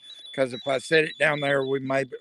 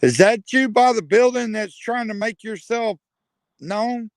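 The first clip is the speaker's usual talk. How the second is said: louder, higher call into the dark; 7 semitones higher, 5 dB louder than usual. Real, not acted. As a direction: call out to someone unseen. Calling out to a spirit.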